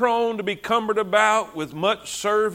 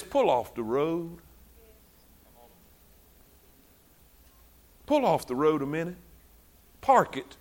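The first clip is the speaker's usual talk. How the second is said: God speaking gently; 5 semitones lower, 7 dB quieter than usual. Retold or imitated. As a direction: speak as a calm God telling him to stop. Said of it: "And God says".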